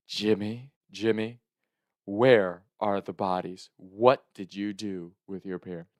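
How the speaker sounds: slow, grave questioning; measured, stern phone interrogation; serious grandma. Retold or imitated. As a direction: imitate grandma turned dead serious. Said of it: "when she gets serious on the phone".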